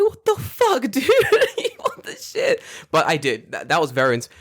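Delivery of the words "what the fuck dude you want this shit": very high squeal with laughter; laughing disbelief. Retold or imitated. Runner retells reacting to the old man, laughing squeal. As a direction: squeal it through a laugh, far higher. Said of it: "I'm like".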